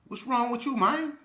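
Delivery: high, loud incredulous exclaim; loud shocked question. Retold or imitated. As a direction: react with disbelief. Staff shocked reaction.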